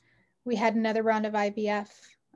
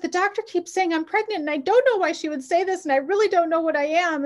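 flustered rambling; 7 semitones higher, 5 dB louder than usual. Retold or imitated. Panicked rambling to husband.

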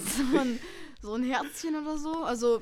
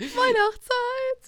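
stretched jingle; 11 semitones higher, 4 dB louder, much slower than usual. Pretend. Mock jingle.

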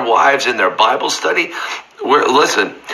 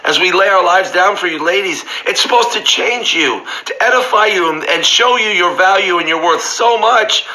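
fervent preaching; 5 semitones higher than usual. Acted. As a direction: lift it up and savour each phrase. Preacher rising to fervent climax.

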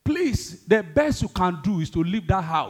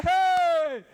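gleeful call; 8 semitones higher, slower than usual. Retold or imitated. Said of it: "He said".

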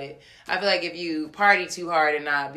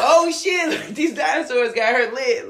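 laughing mock boyfriend; 8 semitones higher, 7 dB louder than usual. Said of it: "he's like".

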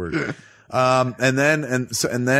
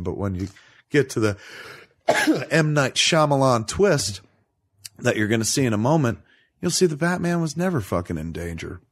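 normal talking voice

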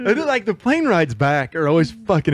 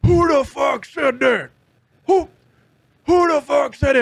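much higher, slow mock angry shout; tough guy angry; 10 semitones higher, 3 dB louder than usual. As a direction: bark it like the guy picking a fight. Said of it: "that one dude that's gotta fucking be tough".

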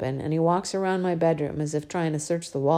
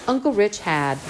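higher, louder disbelieving repeat of Uncle Rich had; father repeating her words in disbelief; incredulous echo; 5 semitones higher, 4 dB louder than usual. Acted. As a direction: throw her words back in shock.

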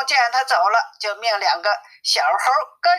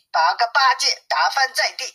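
ordinary delivery, nothing marked

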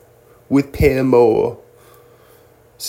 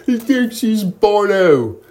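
mock British taunt; 8 semitones higher, 4 dB louder than usual. Brits taunting an American, in a hammed-up British accent.

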